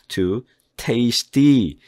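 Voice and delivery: tasty with the first syllable stressed, very slow. Shown as a demonstration. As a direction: lean on TAS in tasty. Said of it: "tasty 할 때 앞에 액센트가". (tasty 첫 음절에 강세)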